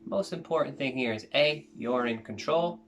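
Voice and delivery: soft, calm meditation guide voice; guided meditation. Acted.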